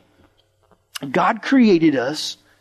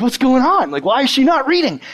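high-pitched mock bewilderment; baffled classmates; 8 semitones higher, 4 dB louder than usual. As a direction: imitate confused kids, higher and insistent. Puzzled classmates in retold story.

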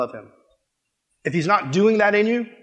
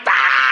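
scream; 5 dB louder than usual. Retold or imitated. Dentures coming at the kid.

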